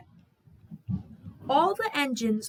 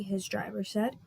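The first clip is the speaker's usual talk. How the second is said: usual speaking voice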